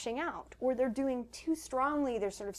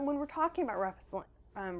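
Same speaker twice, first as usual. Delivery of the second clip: ordinary talk, nothing marked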